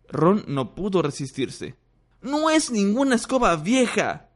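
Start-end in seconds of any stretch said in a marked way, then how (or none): 2.2-4.3 put-on high young boy's voice; boastful boy. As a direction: brag it to his rival. Ron boasting to Malfoy.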